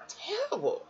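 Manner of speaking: whispered, high and breathy terrible. Real, not acted. Telling viewers how she felt, whispered. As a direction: let terrible fall to a whisper. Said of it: "I just felt".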